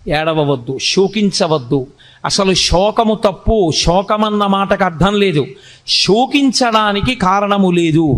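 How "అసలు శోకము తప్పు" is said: ordinary talk, nothing marked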